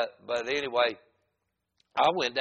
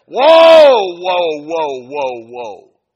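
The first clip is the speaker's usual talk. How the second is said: very loud, high-pitched holler; frantic stopping shout; 20 dB louder, much slower than usual. Retold or imitated. Man cutting off the prayer, frantic shout. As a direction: yell to halt him at once. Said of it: "he stopped me like that".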